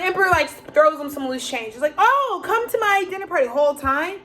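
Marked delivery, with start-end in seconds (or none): 2.0-3.5 higher, sweet fake-friendly host; mock sly invitation. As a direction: lure them in with a sugary invite. Emperor's sly invitation voiced.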